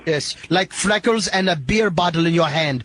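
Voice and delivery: a light, hammed-up Indian accent; put-on Indian accent. Prank caller quizzing the Irishman, in a light mock Indian accent. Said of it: "stupid of an accent".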